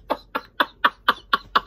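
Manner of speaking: breathy, voiceless laugh; silent wheezing laugh. Real, not acted. Reviewer doubled over, silent wheezing laugh.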